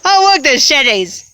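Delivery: amused, high and loud mock exclamation; imitating own slip. Retelling a sick-day word mix-up, amused high exclamation. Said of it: "I said the".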